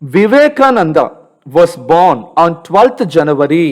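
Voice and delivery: very slow, word by word, for pupils to follow; slow read-aloud. Shown as a demonstration. Teacher reading slowly so pupils can follow his voice. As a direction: read each word slowly so the class can copy you. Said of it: "నా వాయిస్ని follow అవ్వాలండి".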